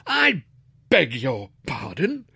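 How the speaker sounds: high start, then slow disbelief; incredulous, slowly spaced demand; affronted father. A narrator's reading. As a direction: draw it out, deeply affronted. Father incredulous at son's defiance.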